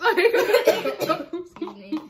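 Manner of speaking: slow, laughing apology. Real, not acted. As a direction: laugh through the excuse me. Burps mid-challenge, laughing.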